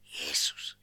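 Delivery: a scared whisper into the phone; shy believer whispering into the phone; whispered name. Retold or imitated. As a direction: whisper it into the receiver so no colleague hears. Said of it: "så visker han ind i røret".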